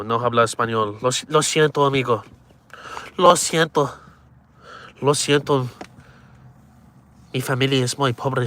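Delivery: hammed-up Spanish accent, pleading; mock Spanish accent. Mock Spanish beggar bit.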